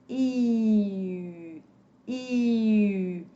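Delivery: long "I" said through rounded lips; rounded-lip French U. Shown as a demonstration. Teacher drilling the biquinho sound, "I" long with rounded lips. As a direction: hold "I" steadily with a tight pout, giving French u. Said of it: "vai emitir o som do I".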